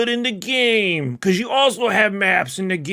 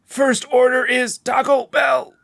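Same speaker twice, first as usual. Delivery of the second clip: shouted ad line; 6 semitones higher than usual. Pretend.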